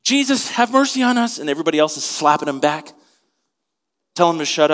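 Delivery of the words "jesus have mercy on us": much higher, straining call for Jesus; mock desperate cry. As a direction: call over a noisy crowd, pitched high. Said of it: "They're trying to find Jesus".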